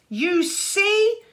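put-on a boy gloating over his abandoned rival; triumphant crow. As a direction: crow it in victory. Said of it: "crowed Luther triumphantly".

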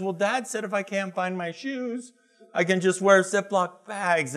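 put-on high, innocent child voice; little boy's voice. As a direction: play the kid explaining himself.

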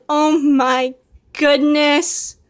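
ordinary talk

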